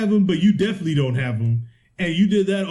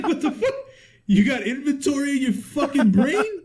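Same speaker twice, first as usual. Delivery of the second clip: much higher, cracking up mid-line; laughing disbelief; 9 semitones higher than usual. Retold or imitated. Retelling his reaction to the clerk, laughing high. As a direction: squeal it through laughter at the clerk's nonsense. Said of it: "I was like".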